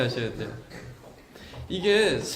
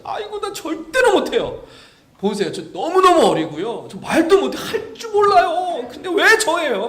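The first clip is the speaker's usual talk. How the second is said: higher, louder pleading; childish whine; 9 semitones higher, 5 dB louder than usual. Retold or imitated. Jeremiah pleading with God, childish whine. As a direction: wail it like a kid who's too young. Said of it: "막 그러면서".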